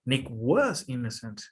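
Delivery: was stretched and pushed; stress on was. Shown as a demonstration. Teacher repeating example for a student, stress on was. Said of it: "we add more emphasis to it".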